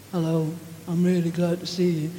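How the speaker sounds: cold throwaway greeting; flat, uncaring hello. Shown as a demonstration. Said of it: "you're not communicating".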